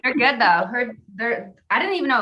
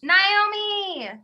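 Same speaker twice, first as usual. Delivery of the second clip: calling out; 10 semitones higher than usual. Real, not acted. Calling out to friend on call.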